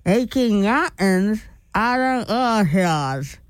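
slow, garbled through a mouth guard; 'Aching mountains out of our hills' mumbled slowly through a mouth guard. Real, not acted. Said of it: "the toughest sentence of all week".

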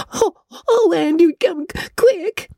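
nervous pleading. Acted. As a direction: beg for help, voice cracking in fright. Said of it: "implored Diana nervously".

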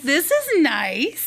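pleased, drawn-out. Retold or imitated. Finding they were put inside, pleased and drawn out. Pleased reaction to indoor spot.

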